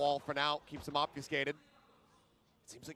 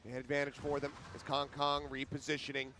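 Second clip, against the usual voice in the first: fast live play-by-play call; esports caster; 6 semitones higher, 6 dB louder than usual. Real, not acted. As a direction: call the round as it happens.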